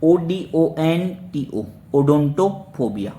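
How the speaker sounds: letters dictated slowly, one by one; slow spelling out. Shown as a demonstration. Teacher to students, spelling the root slowly. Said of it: "देखो क्या लिखा हुआ है?".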